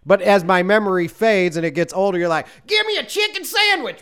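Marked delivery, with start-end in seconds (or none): 2.7-4.0 high, far-off hillbilly holler; yelling Southern accent. Retold or imitated. Friend ordering at a restaurant, mock Southern holler. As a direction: bark the order like a rowdy Southerner. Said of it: "what you sound like in my head when you're yelling".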